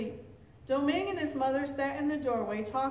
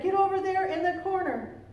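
louder, high-pitched command; urgent order; 7 semitones higher, 5 dB louder than usual. A narrator's reading. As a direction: order the child to hide. Old woman orders boy to hide.

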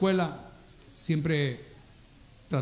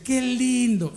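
higher, forced sweet "qué lindo"; fake praise; 8 semitones higher, 3 dB louder, much slower than usual. A visitor cooing politely over an ugly newborn. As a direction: coo over the baby insincerely. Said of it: "alguien dice".

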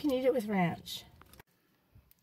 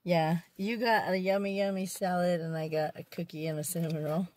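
ordinary delivery

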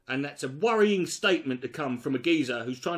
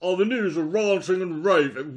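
mocking imitation; 5 dB louder than usual. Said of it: "did you just say ranting and raving".

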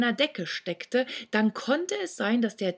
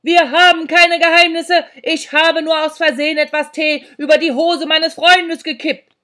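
much louder, higher shout for a deaf listener; bellowing boy; 9 semitones higher, 12 dB louder than usual. Acted. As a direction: yell it so the old woman hears. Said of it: "brüllte Sandro". (laut gebrüllt)

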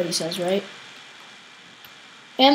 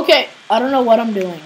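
ordinary talk

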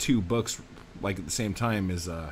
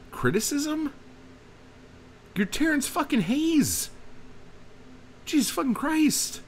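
incredulous rant; 13 semitones higher than usual. Real, not acted. Exasperated rant at Terrence Hayes.

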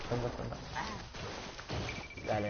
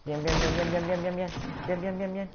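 excited cheering; 5 semitones higher than usual. Real, not acted. Celebrating kill.

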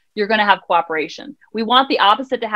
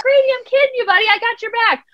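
playful reassurance; 12 semitones higher than usual. Retold or imitated. Mother reassuring son playfully.

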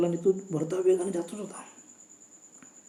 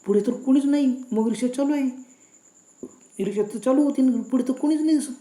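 raised, louder voice of alarm; baffled fright, pitch jumping higher; frightened; 5 semitones higher, 5 dB louder than usual. A narrator's reading. Couple sees nobody driving the moving rickshaw, frightened. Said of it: "दोघे एकदम घाबरून पाहू लागले".

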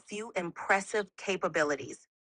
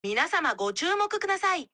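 faster; 8 semitones higher, faster than usual. Shown as a demonstration.

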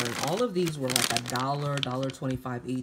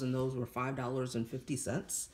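ordinary talk, nothing marked

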